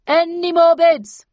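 loud, slow auctioneer's pitch. A narrator's reading. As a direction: sell the lot to the room. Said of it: "asked the auctioneer".

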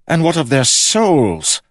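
high, loud, slow, troubled demand; genuinely disturbed. A narrator's reading. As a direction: press it as a disturbed king. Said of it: "seemingly genuinely disturbed".

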